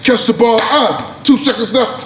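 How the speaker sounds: ordinary talk, nothing marked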